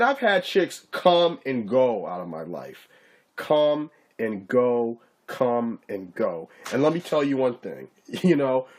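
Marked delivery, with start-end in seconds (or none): 3.4-6.5 slow, fading shrug of a phrase; slow dismissive drawl. Real, not acted. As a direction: let it trail off, unbothered. Shrugging off breakups, slowing down.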